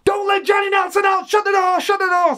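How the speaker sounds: high-pitched imitated trainer's yell; urgent shouting. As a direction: shout urgent orders across the gym. Acts out trainer yelling urgent orders.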